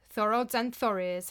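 plain reading voice

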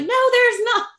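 high, loud flat-out refusal; stubborn denial. Retold or imitated. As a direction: snap back in disbelief. Denying mom in labor.

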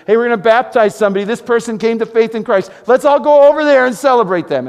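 loud, high, fast announcement to the whole church; rally the church like a cheerful herald; calling out to everyone. Retold or imitated. Pastor calling the church to a baptism, loud and eager.

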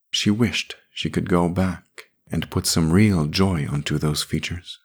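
usual speaking voice

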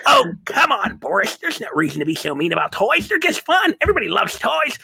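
the nerd half pleads with Boris, high and eager; toy nerd voice. Retold or imitated. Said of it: "this side of my face was a huge nerd".

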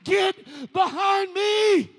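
high, drawn-out full-force shout; commanding preacher's shout, stretched on each word; shouted rebuke. Acted. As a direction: roar it slowly as the sermon's climax. Climax of sermon, rebuking the voice.

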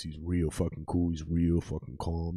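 low, soft, laid-back voice; imitating a mellow, unbothered man; real cool, calm guy. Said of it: "And he talks like this".

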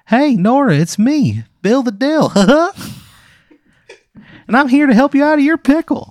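very high, loud and chirpy; a cheerful little pickle introducing himself; squeaky cartoon pickle. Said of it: "He goes".

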